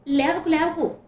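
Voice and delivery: urgent call. A narrator's reading. Harika stops her fainted friend rising, urgent call. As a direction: call out to stop her getting up. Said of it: "అని అరిచింది".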